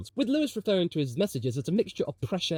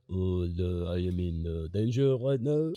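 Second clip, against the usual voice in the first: low, slow, droning monotone; Ralph Wiggum meme; 8 semitones lower than usual. Acted. Vlogger mocking a team boss through a meme, low slow impression. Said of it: "look at the Ralph Wiggum meme and think".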